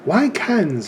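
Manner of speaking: imitating a British teacher, pitch leaping up in disbelief; rough mock-British accent. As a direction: put on a puzzled British voice. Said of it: "Excuse my British accent".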